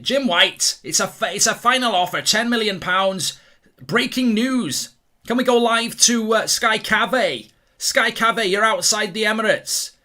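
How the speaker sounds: loud, high-pitched parody sports newsreader; over-excited mock news bulletin; mock breaking news. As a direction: shout it like a transfer-deadline TV exclusive. Parodies a live sports news broadcast.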